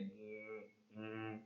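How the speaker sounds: soft closed-mouth hum like a vibrating phone; phone buzzing hum. Retold or imitated. Teacher imitating a vibrating phone, soft buzzing hum. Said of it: "vibrating like".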